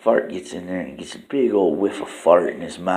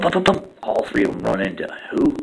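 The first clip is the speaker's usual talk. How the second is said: usual speaking voice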